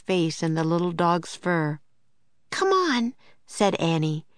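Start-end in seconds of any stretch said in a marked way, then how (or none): 2.5-3.1 put-on much higher girlish "Come on" with a falling end; girl's urging call. A narrator's reading. Annie urges Jack onward, high girlish voice. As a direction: hurry him along, eager kid.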